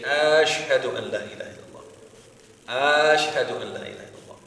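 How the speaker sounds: long "Aash" opening "Ashhadu"; lengthened hamza. Shown as a demonstration. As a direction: stretch the first vowel of "Ashhadu". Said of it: "the first one is maddul hamza".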